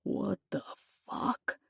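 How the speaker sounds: whispered, stunned curse; hushed disbelieving whisper; soft whisper. A narrator's reading. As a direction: breathe it under your breath. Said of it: "a soft".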